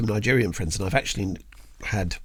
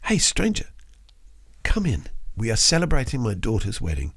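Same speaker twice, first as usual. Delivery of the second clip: Indian lady's welcome. Retold or imitated. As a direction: warmly invite them in with a half-done Indian accent. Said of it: "But she says".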